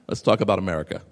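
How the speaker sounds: normal talking voice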